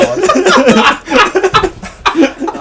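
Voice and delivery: high loud real laugh. Real, not acted. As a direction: crack up at the memory. Laughs at the story.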